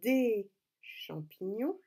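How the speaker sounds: spell-it-out slow teacher delivery; slow dictation. Shown as a demonstration. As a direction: draw each syllable out for writing. Dictating words slowly to pupils. (dictée lente, syllabe par syllabe)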